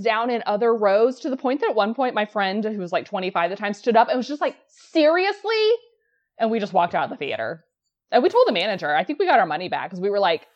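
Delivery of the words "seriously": high, drawn-out incredulous outburst; exasperated disbelief. Retold or imitated. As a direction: imitate a fed-up friend, pitched way up. Fed-up friend stands up in theater.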